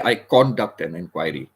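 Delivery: "conduct" wrongly as CON-duct, full "o"; misplaced first-syllable stress. Shown as a demonstration. Teacher shows a mistake, first syllable of "conduct" stressed loudly. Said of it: "you cannot say".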